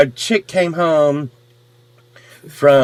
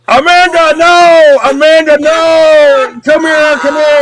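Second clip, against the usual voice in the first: very high, loud pleading yell; hammed-up desperate calling out; desperate mock shout; 12 semitones higher, 8 dB louder, slower than usual. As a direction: holler after her, begging her back. Acting out pleading with fleeing girl.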